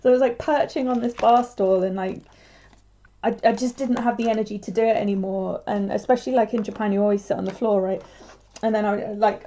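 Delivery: ordinary talk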